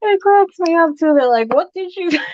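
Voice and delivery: high-pitched, cracking up while talking; laughing. Real, not acted. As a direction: laugh your way through the words. Cracking up retelling kids' question.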